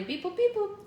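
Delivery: high beeping robot bleeps; toy robot voice. Pretend. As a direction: censor with robot beeps. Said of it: "Robot beep boop".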